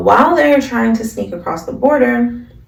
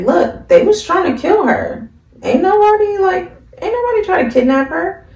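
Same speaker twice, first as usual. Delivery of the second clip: hero voice; 11 semitones higher, 3 dB louder than usual. Retold or imitated. Shield hero defends Melty, retold.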